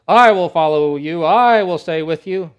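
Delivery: loud, slow, puffed-up promise; proud loud vow. Acted. Peter loudly and proudly promising to stick with Jesus. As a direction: declare it proudly. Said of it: "Peter says".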